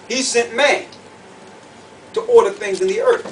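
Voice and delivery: ordinary delivery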